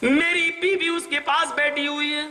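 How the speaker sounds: ordinary talk, nothing marked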